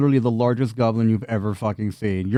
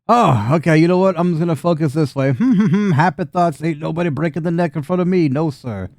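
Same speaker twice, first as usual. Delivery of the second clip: nervous Rufus; 5 semitones higher than usual. Acted. Rufus looking away from the brute, nervous and higher. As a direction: talk yourself calm, laughing nervously. Rufus averts his eyes.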